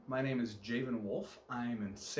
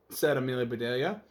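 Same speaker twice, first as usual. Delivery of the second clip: usual speaking voice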